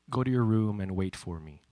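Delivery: low, quiet command; acted-out controlled fatherly anger; stern dad. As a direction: give the order softly, voice dropped. Acts dad's ominous order.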